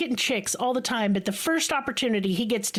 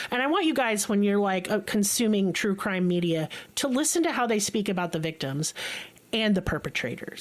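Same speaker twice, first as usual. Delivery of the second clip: normal talking voice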